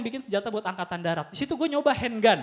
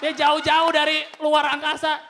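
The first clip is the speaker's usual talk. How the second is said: ordinary talk, nothing marked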